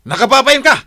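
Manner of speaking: loud, high furious challenge; a jealous husband loses his temper; angry yell. Acted. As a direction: bark the question at her. Husband yells at wife.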